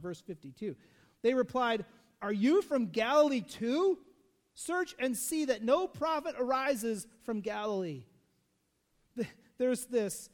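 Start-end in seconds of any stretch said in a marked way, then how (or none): 2.2-8.0 louder, slower sneering jab; mocking insult. Pharisees insulting Nicodemus as a Galilean, louder and slow. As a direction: sneer down at him.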